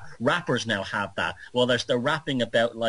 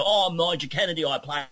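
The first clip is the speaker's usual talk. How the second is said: [name]'s Mockney; 3 semitones higher than usual. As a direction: imitate a well-spoken violinist putting on Cockney. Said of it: "he does the same thing there".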